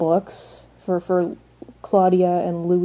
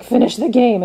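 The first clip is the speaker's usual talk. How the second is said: mildly irritated mock command, a bit higher; ticked off; 4 semitones higher, 4 dB louder than usual. Reviewer imitating Lestat after chess checkmate, mildly ticked. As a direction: imitate a peeved order to keep playing. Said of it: "Lestat is ticked because he's like".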